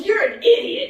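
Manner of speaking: high, loud mock doctor; blunt doctor. Doctor dismissing the injured son, high and loud. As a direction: bark the insult with glee. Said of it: "Doctor goes".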